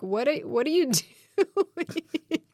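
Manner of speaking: high, drawn-out mock horror with laughter; horrified husband. Husband walking in on her accent audition, mock horrified and laughing. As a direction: imitate appalled disbelief, slow and high, laughing through it. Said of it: "he was a little bit horrified".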